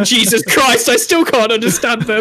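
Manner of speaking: high, exasperated outburst through laughter; exasperated caller. Acted. Caller still baffled by a Liverpool accent, laughing exasperation.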